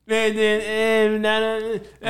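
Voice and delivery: a tipsy brother mumbling nonsense; drunk brother. Retold or imitated. A tipsy brother mumbling nonsense, lightly slurred. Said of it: "Nazir, my brother".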